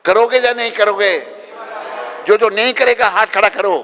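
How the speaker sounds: loud, high call to the crowd; shouted challenge. Real, not acted. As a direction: demand an answer from the whole crowd. Challenges the crowd to raise hands.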